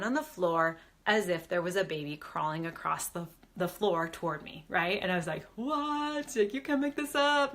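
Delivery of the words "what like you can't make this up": high, astonished what, pitched well up; reliving the moment she could hardly believe it; amazed disbelief. Retold or imitated. Telling viewers how the baby looked at the floor, high amazed disbelief.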